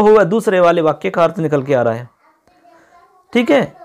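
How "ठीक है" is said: ordinary delivery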